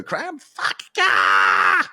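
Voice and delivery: acted breakdown scream; wild mock meltdown yell; losing their mind. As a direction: burst out screaming like someone snapping. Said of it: "lose their minds".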